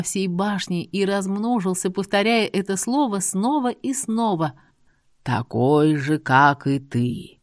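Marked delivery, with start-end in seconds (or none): 5.2-7.4 eerie djinn voice. A narrator's reading. Djinn's parting words.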